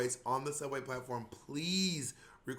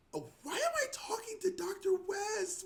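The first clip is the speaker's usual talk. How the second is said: high incredulous squeal. Retold or imitated. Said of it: "I was like".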